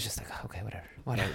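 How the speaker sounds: dismissive whisper; whispered dismissal. Real, not acted. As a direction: breathe it out under your breath.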